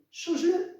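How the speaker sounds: sudden high, loud command; emphatic exclamation. Acted. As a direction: fling it out as a bold order. Teacher urging students to change.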